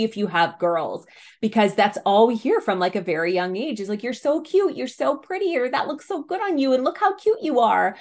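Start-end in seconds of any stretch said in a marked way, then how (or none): 4.0-8.0 higher, sing-song gushing praise; sugary adult cooing at a child; gushing compliments. Retold or imitated. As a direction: coo over a little girl. Mimicking compliments to little girls.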